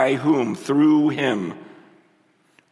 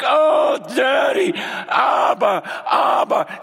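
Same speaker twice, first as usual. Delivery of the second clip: retold crying out; 9 semitones higher, slower than usual. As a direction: cry out with longing, then trail off. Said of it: "just like".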